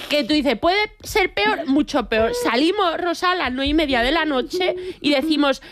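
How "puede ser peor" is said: dramatic warning. Pretend.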